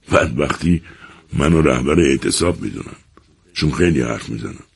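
ordinary delivery

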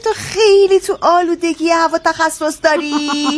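mock his expertise with stretched words; sarcastic. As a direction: sister sneering at her brother. Mocks brother's claimed expertise.

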